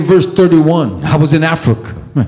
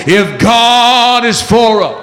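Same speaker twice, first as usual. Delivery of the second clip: drawn-out preacher's cadence, raised pitch; 5 semitones higher, slower than usual. Shown as a demonstration. Preacher cueing congregation to answer, in preacher voice. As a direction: stretch it out like a preacher. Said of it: "let me get in the preacher voice".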